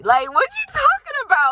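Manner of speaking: mock indignant. Mocking the elder's accusation.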